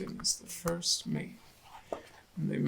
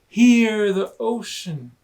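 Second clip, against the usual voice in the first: wistful excitement; 5 semitones higher, 10 dB louder than usual. Acted. Hermit Crab wishing.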